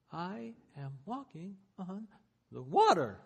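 slow, hushed, then a burst on "the water"; dawning wonder, then a sudden loud burst; amazed realization. Acted.

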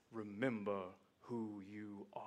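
slow, low, hushed Mufasa; lightly voiced deep-voiced lion king, slow and hushed; a hint of Mufasa from the clouds; James Earl Jones voice. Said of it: "in this James Earl Jones voice".

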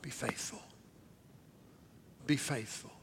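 very soft, low repetition; hushed plea. Acted. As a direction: say it softly, almost under breath. Repeated hushed exhortation.

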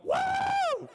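loud high-pitched whoop; crowd's woo. Retold or imitated. Students answering where T-Dub is, loud high whooping. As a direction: imitate a cheering crowd with a piercing woo. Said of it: "And you're all like".

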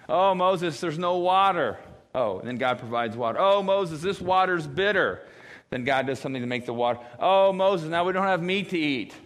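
mock whining complaint. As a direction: whine at Moses like spoiled kids. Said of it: "times where the people complain".

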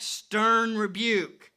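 normal talking voice

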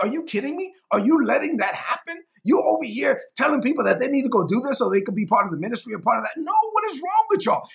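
high-pitched, exasperated rebuke; acting out a frustrated apostle telling people off. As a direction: scold them in disbelief, voice climbing high. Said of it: "this is why it frustrated Paul".

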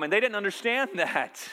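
ordinary talk, nothing marked

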